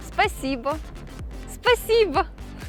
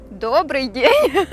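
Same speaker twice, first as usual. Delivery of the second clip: loud, drawn-out greeting, cracking up; breaking into laughter; 8 dB louder, much slower than usual. Real, not acted. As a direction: stretch it slowly till laughter takes over. (громко, нараспев, срываясь на смех)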